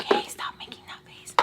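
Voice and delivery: whispered order to oneself; hushed self-scolding. Retold or imitated. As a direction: whisper it softly, like scolding your own face. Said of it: "tell my face".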